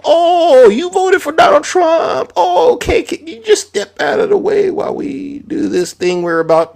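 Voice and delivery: high, slow, falsetto mock politeness; mock soldier. Mocking the National Guard in a sugary falsetto. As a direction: squeak it like a fake polite guard. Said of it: "they ain't gonna go".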